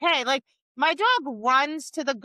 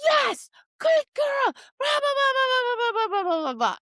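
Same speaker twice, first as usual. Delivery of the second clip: high-energy praise; 12 semitones higher than usual. Retold or imitated. Imitating a student praising her dog, hammed-up high energy. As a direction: squeal it, then babble on, sliding down. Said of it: "like, high energy".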